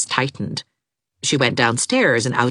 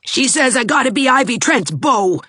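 snarling; 10 semitones higher, 4 dB louder than usual. A narrator's reading. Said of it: "snarled Gerald".

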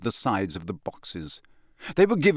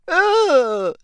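startled gasp; 6 dB louder than usual. Acted. Lavender startled.

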